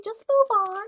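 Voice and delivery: teasing the bored viewers, high and bright; playful high brush-off. Acted. Vlogger shooing off viewers bored by pens, playfully high.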